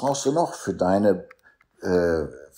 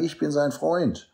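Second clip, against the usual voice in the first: raised, higher voice declaring loyalty; staunch friend; 8 semitones higher than usual. Retold or imitated. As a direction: speak up firmly for your friend. Voices loyal friend standing by him.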